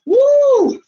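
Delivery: high loud squeal; scared squeal. Real, not acted. Imagining the tide, a high loud squeal. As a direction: squeal at the thought of the water. Imagining being buried by the waves.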